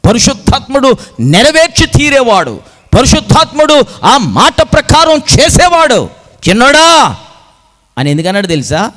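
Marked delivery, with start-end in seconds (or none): 0.1-7.2 high, ringing preaching cry; full-force preaching. Real, not acted. Preacher proclaims that the Spirit fulfils the word, high and forceful. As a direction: proclaim it at the top of the range.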